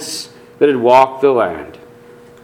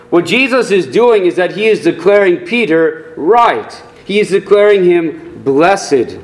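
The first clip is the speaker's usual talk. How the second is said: normal talking voice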